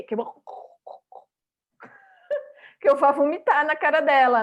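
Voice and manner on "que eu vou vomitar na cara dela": laughing through the words, louder; a laughing joke; real laughter while talking. Real, not acted. Jokes about expected reaction.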